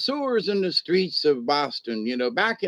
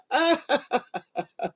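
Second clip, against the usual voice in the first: high burst of laughter; 10 semitones higher than usual. Real, not acted. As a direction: let out a big high laugh. Big laugh after tease.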